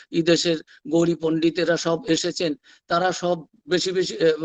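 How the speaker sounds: normal talking voice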